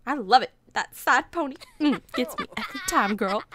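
mock flirty compliment. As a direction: gush over-the-top at your sweetheart. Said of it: "comment her appearance".

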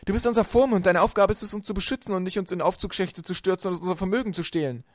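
loud, high-pitched accusation; indignant shouting. A narrator's reading. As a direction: shout your outrage at the guardian. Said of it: "rief Violet". (empört rufend, laut und hoch)

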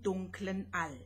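usual speaking voice

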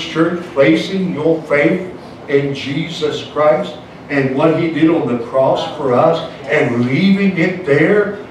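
normal talking voice

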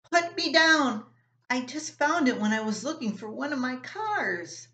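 put-on little boy dangling by his collar crying out; alarmed little brother. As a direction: squeal to be put down. Russell lifted off the floor.

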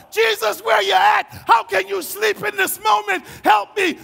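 high, loud desperate plea; freaking out. Retold or imitated. Preacher as believer with bad news, shouting desperately for help. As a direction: cry out in panic. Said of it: "I'm freaking out".

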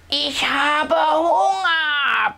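slow, high roaring dino bellow; roaring hunger. A narrator's reading. Hungry dinosaur roaring at the swamp, slow roar. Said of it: "brüllt Tilo".

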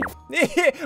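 short, high giggle; quick mischievous laugh. Real, not acted. Chuckles over insane ability.

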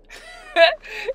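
high squealing laugh; shrieking laugh. Real, not acted. Shrieking laugh recalling tick.

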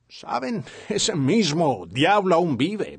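ordinary talk, nothing marked

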